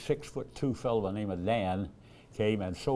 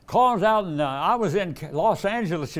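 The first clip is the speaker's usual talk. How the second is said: higher, louder woman's voice in a retold chat; voicing the lady; 9 semitones higher, 6 dB louder than usual. Preacher voicing a lady passenger, higher and louder. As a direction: lift the voice to play the flustered lady passenger.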